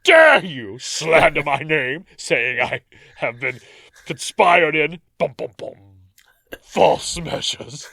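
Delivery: loud, pompous, hammed-up indignation; outraged magistrate. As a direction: play the offended bailiff to the hilt. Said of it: "He's like".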